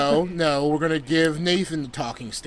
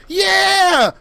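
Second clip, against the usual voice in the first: excited; 5 dB louder than usual. Retold or imitated. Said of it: "and I'm like".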